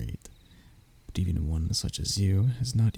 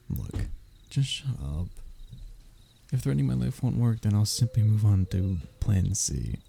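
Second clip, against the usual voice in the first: normal talking voice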